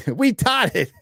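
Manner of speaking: slow, high, giggling "We taught it"; amused, breaking into laughter on the pun; laughing through it. Real, not acted. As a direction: crack up while saying it.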